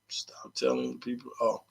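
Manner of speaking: faint muttered repeat; whispering. Real, not acted. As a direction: say it almost silently. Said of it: "Stop telling the people that".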